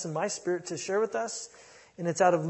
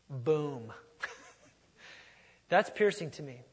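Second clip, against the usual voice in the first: normal talking voice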